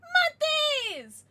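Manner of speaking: high, loud announcement; excited cheer. Real, not acted. Streamer thanking a subscriber, an excited high cheer. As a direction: hype up the subscriber.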